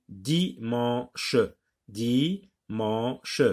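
"dimanche" as di, man, che; split into syllables. Shown as a demonstration. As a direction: pause between the syllables of "dimanche". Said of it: "Donc j'ai séparé".

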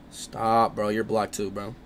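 ordinary delivery

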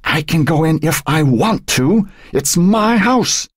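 put-on high, indignant old woman; defiant. A narrator's reading. Old woman defying the fireman, angry and defiant. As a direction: defy him in a high voice, dragging the last words. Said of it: "Emily's eyes flashed dangerously".

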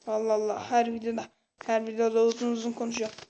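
ordinary talk, nothing marked

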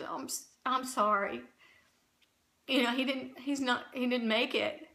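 soft, hesitant bad news; hushed, halting apology; retold gentle condolence. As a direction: re-enact breaking a death gently, faltering. Nurse breaks news of the death.

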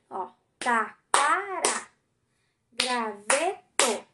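taquara and graveto dragged out slowly, syllable by syllable; slow syllable reading. Shown as a demonstration. Teacher showing the class the three syllables, slowly. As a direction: stretch taquara and graveto so each syllable is heard. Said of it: "três sílabas".

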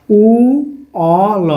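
"উ অ ল" with each sound held separately. Shown as a demonstration. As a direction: break "উ অ ল" apart with pauses. Spelling the word sound by sound.

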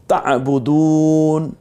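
তা'বুদুন recited very slowly, du drawn long; lengthened du. Shown as a demonstration. Teacher reciting, holding the du of তা'বুদুন. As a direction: stretch দু in তা'বুদুন. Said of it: "দালের পরে ওয়াও সাকিন একারিপ টেনেছি".

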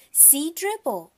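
put-on high, slow toddler voice; little boy. A narrator's reading. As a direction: show it off like a proud small child. Toddler shows the turtle.

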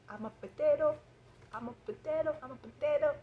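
high, playful cartoonish voice; childish sing-song play voice; silly character voice. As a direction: act it out like a goofy cartoon vegetable. Silly acted potato/tomato dialogue.

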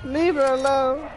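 mocking a South Park character's high desperate plea; South Park guy impression. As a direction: plead way up high like a distraught fan. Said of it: "He was like".

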